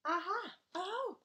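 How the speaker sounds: high, loud triumphant cry; excited discovery. Acted. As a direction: pounce on the find. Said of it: "child discovers track".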